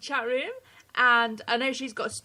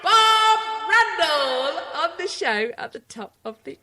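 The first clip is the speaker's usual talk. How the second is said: big announcer build-up; 5 semitones higher, 6 dB louder than usual. Acted. Wife doing her silly announcer bit for husband, hammed up. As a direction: ham up the fanfare for the guest. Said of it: "do your stupid thing".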